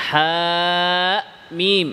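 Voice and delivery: হা মিম recited open, no glottal catch; no hamza. Shown as a demonstration. Teacher reciting the disjoined letters for students, without hamza. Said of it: "এভাবে হামজা নাই".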